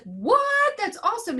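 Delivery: high, excited what, then awesome; surprised delight. Retold or imitated. Teacher hearing the postcard went on the fridge, high excited surprise. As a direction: squeal with surprised joy at the news.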